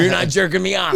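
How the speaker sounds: insistent pestering. Retold or imitated. Friend pesters him by phone.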